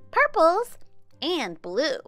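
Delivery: usual speaking voice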